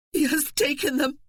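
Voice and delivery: high wailing grief. Acted. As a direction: wail it in despair. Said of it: "recognizing that she was wailing".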